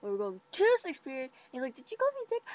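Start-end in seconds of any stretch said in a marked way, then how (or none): 1.8-2.4 much higher offended question; indignant question. Retold or imitated. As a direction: boyfriend asking if she insulted him, high offended question. Boyfriend asking.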